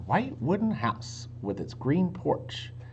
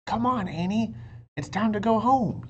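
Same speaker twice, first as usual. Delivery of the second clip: higher, louder call to his sister; Jack calling; 8 semitones higher, 4 dB louder than usual. A narrator's reading. Jack calls Annie into the woods, higher and louder. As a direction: call her home across the woods. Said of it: "yelled Jack".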